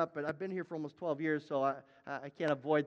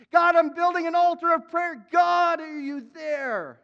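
high, full-force shout to God; crying out; 9 semitones higher, 10 dB louder, slower than usual. Acted. Preacher as Abraham building his altar, crying out loud. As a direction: bellow it upward, stretching the last words. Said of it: "He cries out".

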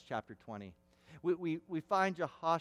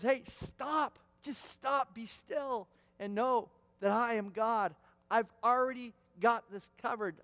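higher voice, emphatic and insistent; firm stop; 6 semitones higher, 3 dB louder than usual. Acted. Jesus stops the complaining disciples, high and insistent. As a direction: cut off their worrying.

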